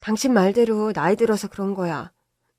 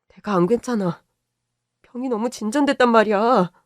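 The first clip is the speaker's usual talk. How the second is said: tearful; 4 semitones higher, 5 dB louder than usual. Acted. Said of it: "울어?".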